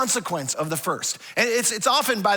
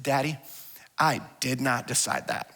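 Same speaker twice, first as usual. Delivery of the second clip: put-on child's deadpan; 8 semitones lower, 4 dB quieter than usual. Son's flat deadpan reply.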